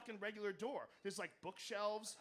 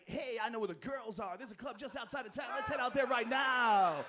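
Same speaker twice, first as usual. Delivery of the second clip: radio DJ voice; 2 semitones higher, 9 dB louder than usual. Retold or imitated. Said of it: "strip club D J voice".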